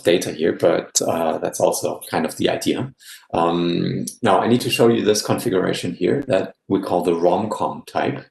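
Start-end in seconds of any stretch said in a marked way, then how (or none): none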